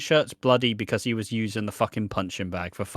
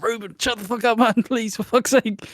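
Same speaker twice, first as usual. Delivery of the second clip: a hint of high urgent hushing; hushing Ruben; 11 semitones higher, 3 dB louder than usual. Friends at a festival hushing their tactless mate, mildly high. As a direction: hiss at your mate to stop, pitched up. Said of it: "It's like".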